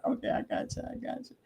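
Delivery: soft unvoiced aside; whisper. Real, not acted. Quietly reassuring herself mid-stream, whispered. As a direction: breathe it to yourself. Aside after chat mix-up.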